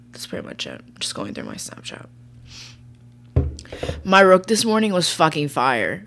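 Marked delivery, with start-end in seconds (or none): 0.1-2.1 unvoiced trailing whisper. Real, not acted. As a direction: whisper it while scrolling. Drifting off reading phone.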